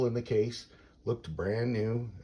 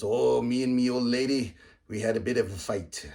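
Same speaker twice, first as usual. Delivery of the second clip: hammed-up Irish pub brogue, higher and louder at the start; terrible Irish accent; 4 semitones higher, 6 dB louder than usual. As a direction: play Finnegan in a bad Irish accent. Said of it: "I apologize for the terrible, uh, terrible, uh, accent".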